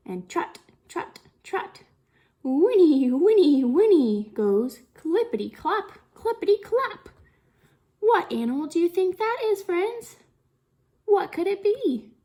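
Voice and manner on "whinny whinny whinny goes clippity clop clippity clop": louder, bouncy horse sounds; loud whinny. Acted. Teacher playing the horse sounds loud for kids. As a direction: belt out the horse noises.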